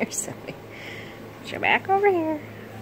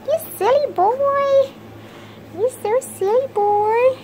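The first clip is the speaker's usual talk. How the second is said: high sing-song pet talk, much higher; baby talk to a pet; 4 dB louder than usual. Acted. Owner greeting the puppy, high cooing baby talk.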